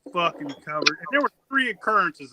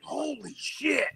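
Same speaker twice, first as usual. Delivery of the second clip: higher, soft, slow awed gasp of a phrase; hushed astonishment; 7 semitones higher, 6 dB quieter, much slower than usual. Retold or imitated. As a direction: breathe it out, stunned by what you see. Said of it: "And they're like".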